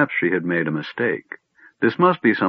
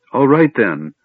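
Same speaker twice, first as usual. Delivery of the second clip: higher, defiant outburst; 5 semitones higher, 4 dB louder than usual. A narrator's reading. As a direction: give in with defiance. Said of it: "Silvara cried defiantly".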